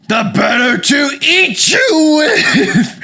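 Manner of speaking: loud, high, roaring wolf; wolf revealed. Acted. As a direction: drop the granny act and pounce with a drawn-out shout. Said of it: "that's when the voice changed".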